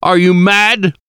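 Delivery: doctor furious at the professor's claim; angry shout. A narrator's reading. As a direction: hurl the question at him in fury. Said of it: "I shouted".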